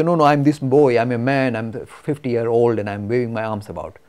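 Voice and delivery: loud mock insistence on who I am; insistent protest. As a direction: protest loudly, waving your arms. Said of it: "even though I say".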